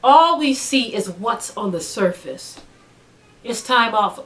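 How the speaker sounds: ordinary delivery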